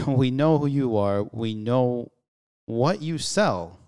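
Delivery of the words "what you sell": normal talking voice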